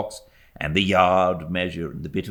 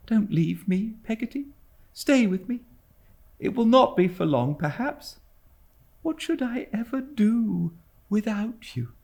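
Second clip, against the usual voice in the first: put-on pleading young mother. A narrator's reading. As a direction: beg her gently to stay.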